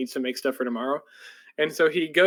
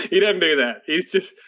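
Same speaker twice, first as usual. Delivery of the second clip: normal talking voice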